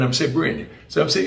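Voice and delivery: usual speaking voice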